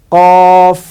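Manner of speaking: teacher sounding out কফ; letter demo. Shown as a demonstration. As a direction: stretch কফ out loud. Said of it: "তারপরে হচ্ছে".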